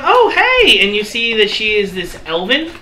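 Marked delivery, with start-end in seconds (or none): 0.0-0.8 high, loud bright-eyed hello; elf woman greeting. Acted. Elven woman greeting a dancer, high and loud. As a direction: squeal a delighted hello. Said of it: "She goes".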